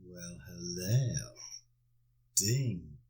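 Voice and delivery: slow, lingering cheeky leer; drawn-out saucy "well, hello"; suggestive. Pretend. Leering at wife changing clothes.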